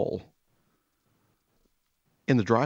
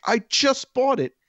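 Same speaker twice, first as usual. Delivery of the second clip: high, slow, louder mock disbelief; incredulous protest; 6 dB louder than usual. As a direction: act out a buddy balking, pitched way up. Buddy balks at selling guitar back.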